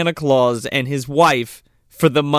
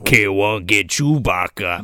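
Jabba the Hutt; 9 semitones lower than usual. Retold or imitated. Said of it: "He sounds like Jabba the fucking Hutt".

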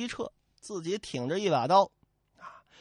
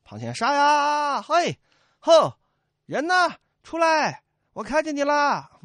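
high, loud hollering at nothing; hammed-up yelling; mock battle shouts; 8 semitones higher, 7 dB louder than usual. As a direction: fake a brave charge. Soldiers pretend to charge.

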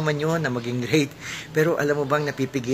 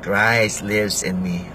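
slow solemn declaration; 4 semitones lower, slower than usual. Shown as a demonstration. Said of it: "Can you say it?".

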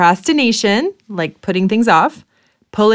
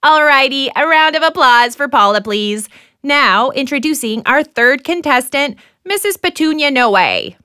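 game show host; 4 semitones higher than usual. Acted.

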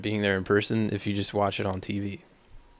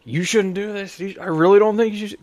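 high, louder worried parent voice; mock worried parent; 10 semitones higher, 4 dB louder than usual. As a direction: squeak out a fretting parent's warning. Parents warning, voiced mockingly.

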